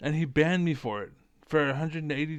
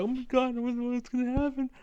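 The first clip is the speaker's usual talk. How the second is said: very high, strained mock-fearful voice; hammed-up high-pitched dread; mock panic; 11 semitones higher than usual. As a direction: imitate frightened chatters, pitched way up. Mimics chat room panicking.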